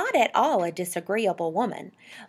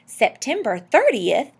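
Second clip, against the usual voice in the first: louder, higher, drawn-out question; excited question; 5 semitones higher, 8 dB louder than usual. A narrator's reading. Husband catching the date his wife mentioned, excited. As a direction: seize on the date with rising excitement. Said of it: "said Mister Popper in an excited tone".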